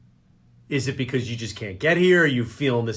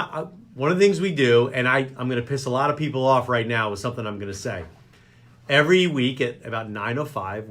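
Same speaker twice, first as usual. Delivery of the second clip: ordinary delivery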